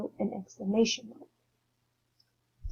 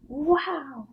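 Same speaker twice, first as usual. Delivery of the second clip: excited wow; 6 semitones higher than usual. Shown as a demonstration. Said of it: "you need to be like".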